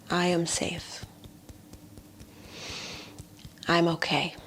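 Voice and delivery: hushed, near-whispered self-reassurance; soothing affirmation. Shown as a demonstration. Healer modelling words to soothe the nerves, hushed affirmation. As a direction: soothe yourself very softly, pausing between phrases.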